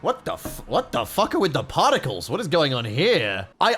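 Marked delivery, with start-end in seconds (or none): none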